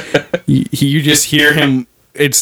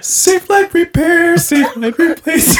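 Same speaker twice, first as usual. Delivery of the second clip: higher, slower ad-jingle singsong; mock commercial jingle; 11 semitones higher than usual. Parodying an insurance jingle, mock ad singsong. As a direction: croon it like a cheesy insurance ad. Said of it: "like a State Farm life insurance commercial".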